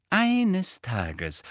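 high, exasperated opening; annoyed, emphatic lead-in; gründlich verärgert. A narrator's reading. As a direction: begin a fed-up lecture. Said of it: "klang gründlich verärgert".

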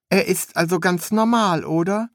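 high, curious apprentice voice; high-pitched inquisitive apprentice; young apprentice, curious. A narrator's reading. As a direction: ask it high, full of naive wonder.

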